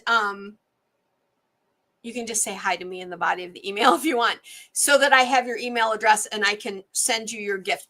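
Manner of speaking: ordinary talk, nothing marked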